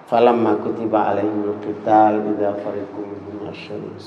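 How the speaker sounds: low, measured Arabic recitation; solemn Quranic quoting; scripture recitation. Real, not acted.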